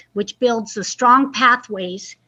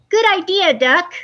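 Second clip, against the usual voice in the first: higher, louder happy praise; cheering animals; 6 semitones higher, 3 dB louder than usual. Acted. Animals cheering Duck, higher happy praise. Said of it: "they all said".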